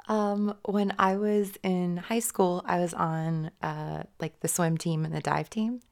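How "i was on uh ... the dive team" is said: usual speaking voice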